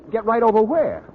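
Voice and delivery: startled. Acted. Boynton startled by sudden request.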